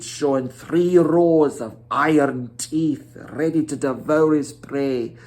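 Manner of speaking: plain reading voice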